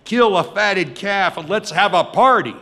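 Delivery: loud, high joyful orders; excited shouting. Acted. Father screaming to servants to prepare a party, excited shouting. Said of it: "He starts screaming to servants".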